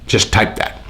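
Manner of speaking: usual speaking voice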